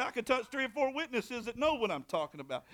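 ordinary delivery

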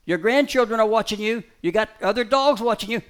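high, loud coaxing to the dog; urging the dog. Real, not acted. Trainer coaxing his dog not to take the bait, loud and high.

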